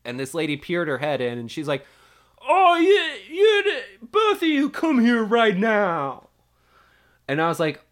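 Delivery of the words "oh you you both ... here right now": high, loud mock shriek with drawn-out words; angry recess monitor. Recess monitor summons two scuffling kids, high furious yell. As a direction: order them over in a shrill, outraged yell.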